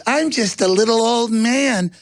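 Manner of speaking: put-on harmless old man. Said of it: "he goes".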